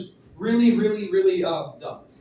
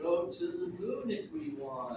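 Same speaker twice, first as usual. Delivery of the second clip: quiet; 4 semitones higher, 15 dB quieter than usual. Real, not acted. Volunteer quietly fills blank.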